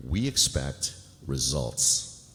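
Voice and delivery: drawn out very slowly, stern; slow stern warning. Acted. Lewis warning the new associate that results are expected, slow and stern. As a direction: lay down the law slowly.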